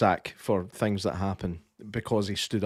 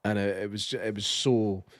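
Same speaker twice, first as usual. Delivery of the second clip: ordinary delivery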